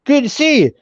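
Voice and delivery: high-pitched, eager welcome; delighted greeting. Retold or imitated. Man shaking a newcomer's hand, excited welcome. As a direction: greet a fellow traveller with sudden enthusiasm. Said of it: "the guy's whole attitude changes".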